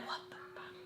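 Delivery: whispered, barely audible reaction; stunned whisper. Retold or imitated. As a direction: whisper it in disbelief. Retold stunned reaction under breath.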